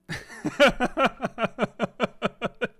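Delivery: high, rapid giggling; helpless run of high ha-ha-has; real laughing fit. Real, not acted. Streamer cracking up after a scare, high real laughter.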